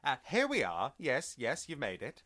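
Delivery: high-pitched mock welcome; put-on character greeting. Co-host jokes as the welcoming voice, high and put-on. Voicing the disembodied voice, jokingly.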